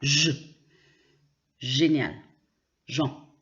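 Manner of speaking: je, Génial, Jean with G as a soft zh sound; soft French G. Shown as a demonstration. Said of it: "souvent on dit". (« je, Génial, Jean » avec un G doux, prononcé j)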